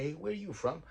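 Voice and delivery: ordinary talk, nothing marked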